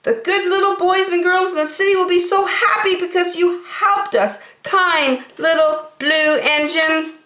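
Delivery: high, happy thanks; grateful clown. Acted. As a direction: thank the engine warmly.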